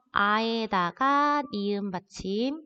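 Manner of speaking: ordinary talk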